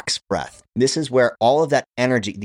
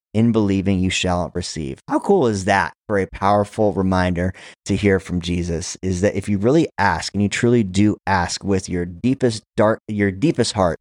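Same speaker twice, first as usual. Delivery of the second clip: ordinary talk, nothing marked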